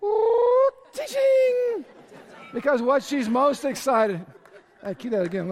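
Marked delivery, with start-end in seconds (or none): 0.0-1.8 high bright ring-gleam noise; sparkle sound. Retold or imitated. Pastor voicing the engagement ring flashing, high sparkle sound. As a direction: make the ring sparkle out loud. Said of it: "because the ring goes".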